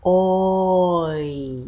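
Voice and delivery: Oi glided from rounded back to spread front; oi diphthong demo. Shown as a demonstration. Teacher showing learners the oi sound, drawn out. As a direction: model Oi slowly with lips rounding then spreading. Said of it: "start kind of mid and back ... end high in front".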